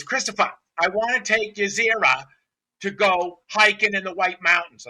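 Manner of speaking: put-on Southie girl. Client asking trainer to take her dog hiking, insistent imitation. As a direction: announce it loudly as the Southie girl. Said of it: "she's from Southie".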